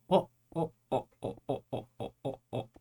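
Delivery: startled panting gasps; scared horror game streamer. Real, not acted. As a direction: shaken breathless oh's after a scare. Jump scare just happened.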